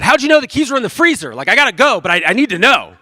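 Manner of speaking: husband begging wife to explain; frantic, hurried need to know; desperate curiosity. Retold or imitated. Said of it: "he said".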